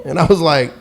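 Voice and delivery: normal talking voice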